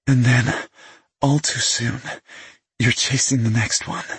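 a hint of her teasing voice; light female voice. A narrator's reading. Woman finishes his sentence.

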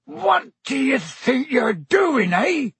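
high, drawn-out outraged roar; big rustic bellow, slow and high. A narrator's reading. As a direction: roar it in sudden alarm. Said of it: "he roared".

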